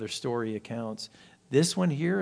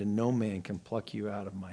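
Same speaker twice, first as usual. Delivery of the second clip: soft, lower whisper of reassurance; whispered promise; 5 semitones lower, 8 dB quieter than usual. Acted. The Lord promising his own, whispered softly. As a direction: breathe it like a secret promise.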